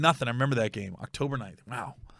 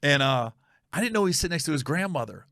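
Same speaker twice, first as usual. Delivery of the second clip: usual speaking voice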